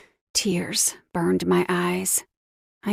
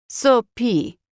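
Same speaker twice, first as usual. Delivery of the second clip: put-on Russian accent; 3 semitones higher than usual. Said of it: "He had a thick Russian accent".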